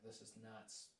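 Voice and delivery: hushed retold disbelief; quiet disbelief. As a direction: say it under your breath. Stranded at sunset.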